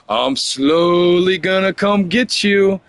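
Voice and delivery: hurricane's creeping threat. Acted. Storyteller voicing a hurricane coming for someone, slow mock menace. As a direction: drawl it slowly like a storm creeping closer. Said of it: "what's a hurricane gonna do".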